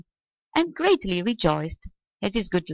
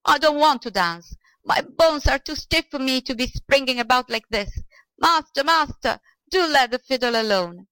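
high-pitched, agitated complaint; shouted plea; 7 semitones higher than usual. A narrator's reading. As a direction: protest in a high, pleading cry. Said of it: "shouted the old man".

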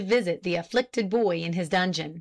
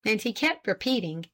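plain reading voice

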